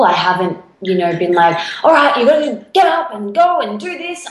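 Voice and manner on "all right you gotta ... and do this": pushy, higher self-command; bossy drill sergeant. Retold or imitated. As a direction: bark orders at yourself. Self-talk, harsh drill voice.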